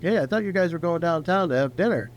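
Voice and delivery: breezy greeting from the bartender who knows you; cheerful bartender. Retold or imitated. Bartender Teodora quoted cheerfully.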